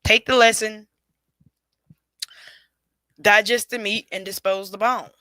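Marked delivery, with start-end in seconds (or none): none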